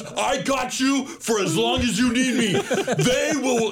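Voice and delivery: high, forceful mobster reassurance; full Scorsese. Retold or imitated. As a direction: bellow orders like a tough Italian protector. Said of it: "he goes full Scorsese".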